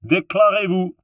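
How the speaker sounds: high, loud sergeant's demand; French challenge. A narrator's reading. Sergeant demanding the men declare themselves, loud challenge. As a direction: bark it as a gate sentry. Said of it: "so he ordered".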